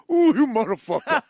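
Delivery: loud, laughing exasperation; laughing mock boss. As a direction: curse at him like a Sicilian foreman. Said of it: "He goes".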